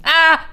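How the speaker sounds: short, loud, high startled cry; startled yelp. Real, not acted. Streamer scared while gluing, startled yelp. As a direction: yelp as if suddenly spooked. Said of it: "That scared me".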